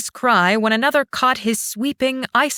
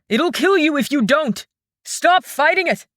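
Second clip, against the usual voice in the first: yelling; 6 semitones higher than usual. Acted. Shang yells at Fen to stop struggling. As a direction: yell the order at her. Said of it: "he yelled".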